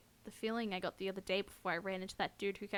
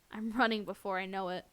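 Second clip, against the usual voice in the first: ordinary talk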